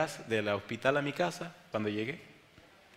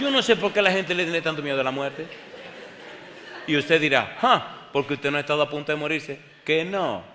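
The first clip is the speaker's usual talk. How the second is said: loud, raised preaching; 4 semitones higher, 7 dB louder than usual. Real, not acted. Preacher challenging the church, preaching at full force. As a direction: proclaim it to the congregation.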